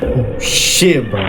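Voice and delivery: loud startled outburst; jump-scared loud exclamation. Real, not acted. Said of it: "that fucking dog scared the shit out of me".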